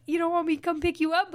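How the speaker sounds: a lilting mock-sweet ask; teasing offer. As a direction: lightly tease him with the offer.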